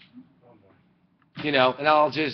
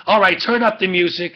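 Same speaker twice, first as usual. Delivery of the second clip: raised, higher party call; loud mock party cheer; partying shout; 6 semitones higher, 7 dB louder than usual. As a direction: call out like a careless reveller. Partiers ignoring the burning people.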